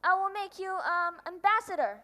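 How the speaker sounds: clinging to his only subject; urgent plea. Acted. King begs prince again.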